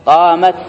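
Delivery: قامت ending on a plain t; long aa, then close قامت on t; silent-vowel final ta. Shown as a demonstration. Teacher demonstrating feminine ta, unchanged in pause. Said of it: "تاء التأنيث ساكنة".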